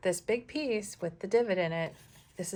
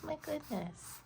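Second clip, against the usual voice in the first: high, soft, squeaky cat voice; 9 semitones higher, 7 dB quieter, slower than usual. Pretend. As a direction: talk like a tiny cat. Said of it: "That was my cat voice".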